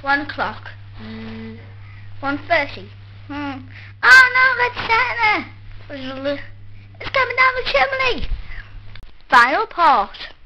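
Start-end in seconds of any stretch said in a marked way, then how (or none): 4.0-8.3 panicked shout. Acted. Kid panics at Santa arriving.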